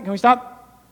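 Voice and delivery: usual speaking voice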